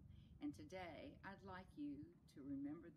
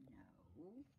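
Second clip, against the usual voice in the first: soft, low whispered reply; 8 semitones lower, 6 dB quieter than usual. Acted. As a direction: whisper the answer, drawing it out. Whispered answer in story dialogue.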